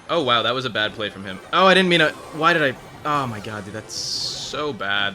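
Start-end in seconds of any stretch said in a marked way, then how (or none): 1.5-3.6 loud sudden yelp, high and loud; startled outburst. Real, not acted. Misplayed a card. 3.6-5.1 slow groan. Real, not acted. Groaning at own bad play.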